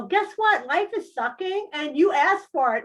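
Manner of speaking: high, loud sing-song gloating; mock spirit voice. Mocking what spirits tell her, high and gloating. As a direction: tease like a smug spirit guide. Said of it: "when you do spirit work and they go".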